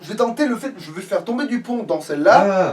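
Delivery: pushing his plan over the other voice; fired-up gamer talk; excited. Real, not acted.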